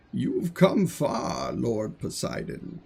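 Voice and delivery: solemn god. Acted. One god greets his brother Poseidon, slow and grave. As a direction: draw it out like a brooding deity. Voices a god in dramatic reading.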